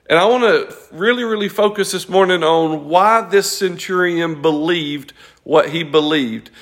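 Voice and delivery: ordinary delivery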